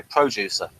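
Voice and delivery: normal talking voice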